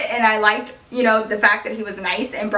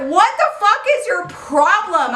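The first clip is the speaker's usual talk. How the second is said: high, heated mock argument; indignant retold outburst; angry demand; 9 semitones higher, 3 dB louder than usual. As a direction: snap it at him like a fed-up girlfriend. Arguing with boyfriend, quoted.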